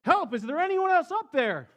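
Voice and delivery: high, loud frantic shouting; man on the cliff yelling for anyone but God. A narrator's reading. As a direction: holler for someone else. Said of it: "Fred yelled up".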